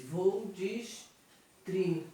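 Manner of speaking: "distribuir" slowly broken into dis-tri-bu-ir; sílaba por sílaba. Shown as a demonstration. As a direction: draw out each syllable of "distribuir", pausing between them. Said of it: "separando a sílaba". ("distribuir" devagar, separando cada sílaba)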